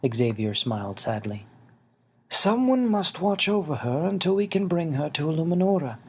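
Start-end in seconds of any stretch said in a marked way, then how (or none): 2.3-6.0 higher, gentle elder voice; a small old man asking kindly; little winged man. Acted. Xavier's voice.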